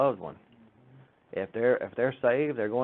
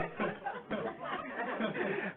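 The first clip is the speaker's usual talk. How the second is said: high breathless laugh; 9 dB quieter than usual. Real, not acted. Laughing at the memory.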